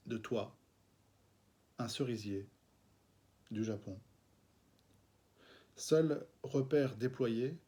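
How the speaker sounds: slow, measured poem reading with long pauses; reading his own verse to the camera; poetry reading. Acted. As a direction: let each image hang in the silence. Reads his sonnet aloud.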